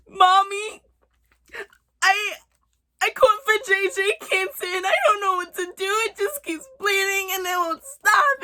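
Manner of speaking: put-on high, teary little girl voice; crying child. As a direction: whimper it like a scared kid sobbing. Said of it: "At this point, I'm sobbing".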